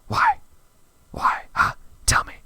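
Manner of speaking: loud indignant why; loud demanding shout at the character; angry shout. Real, not acted. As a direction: bark it at the screen. Yelling at the character.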